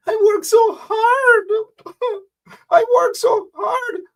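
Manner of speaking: hammed-up blubbering whine; mock crying. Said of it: "going out like babies and crying".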